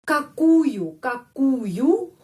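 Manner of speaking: какую slow, every letter clear. Shown as a demonstration. Teacher sounds out какую letter by letter for students. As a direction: spell out какую slowly. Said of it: "setiap huruf dibaca какую".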